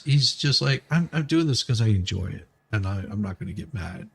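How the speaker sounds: ordinary delivery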